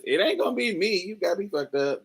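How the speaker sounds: a light put-on girl voice turning him down; sassy girl. Girl in the group refusing the boy, mock indignant. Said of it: "One of 'em says".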